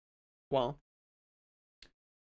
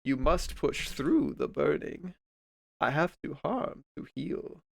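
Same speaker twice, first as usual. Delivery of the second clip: slow, hushed prophetic intoning; Oracle voice; 5 dB quieter, slower than usual. Retold or imitated. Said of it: "just Oracle voice lines".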